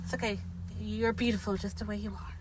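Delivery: quiet, hammed-up sweet talk; soft comfort. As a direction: soothe him like a hurt child. Comforting the burnt-faced actor.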